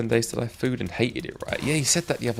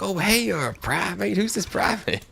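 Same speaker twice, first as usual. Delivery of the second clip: higher, puzzled imitation; mock puzzled streamer; 13 semitones higher, slower than usual. Imitating streamer not recognising the new account, puzzled. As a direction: play the streamer baffled by a new name.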